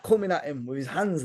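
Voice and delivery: normal talking voice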